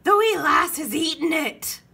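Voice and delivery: high, loud dismayed pirate cry; Braidbeard yelling. A narrator's reading. Pirate captain seeing baby ate map, dismayed yell. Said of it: "yelled Braidbeard".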